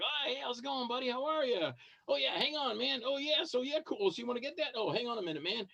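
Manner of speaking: juggling fans. Retold or imitated. Actor retelling busy con table, mock rushed cheer. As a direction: lightly mimic juggling several fans at once. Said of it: "I'm like".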